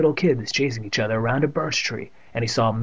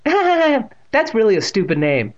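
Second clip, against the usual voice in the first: teasing; 10 semitones higher, 5 dB louder, slower than usual. A narrator's reading. Teasing girl after laughing.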